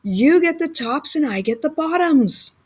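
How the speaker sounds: cheery shout to wake Bear; calling out. A narrator's reading. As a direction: call across the field to the sleeper. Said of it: "Hare called out".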